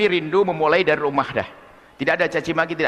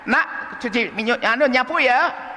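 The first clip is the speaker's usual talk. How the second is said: higher, drawn-out motherly request; mother's voice; 8 semitones higher, slower than usual. Retold or imitated. Mother asking her child to sweep, higher and slow. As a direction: ask the child sweetly to sweep.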